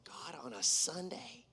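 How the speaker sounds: hushed, whispery plea to God; slow, soft recreation of tearful dismay; retold crying. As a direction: breathe it out as if crying on the phone. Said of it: "I'm crying on the phone".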